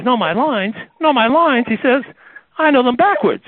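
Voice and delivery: offended actor insisting he knows it; cocky protest to the director; indignant. Retold or imitated.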